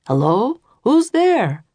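man calling up high into the dark hall. A narrator's reading. A stranger calls to the children in the castle hallway. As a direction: call out, who's there. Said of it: "a man called".

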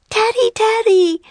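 put-on very high, loud girlish squeal; high-pitched, overjoyed greeting; excited cry. A narrator's reading. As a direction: cry out the name in delight. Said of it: "cried Annie".

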